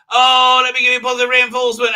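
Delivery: high, loud, fast gushing praise; mocking sweet praise. Reviewer mocking the doctor praising the nurse, gushing. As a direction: gush it out in a sugary mock voice.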